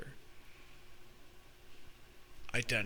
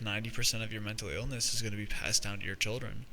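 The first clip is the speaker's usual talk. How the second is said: normal talking voice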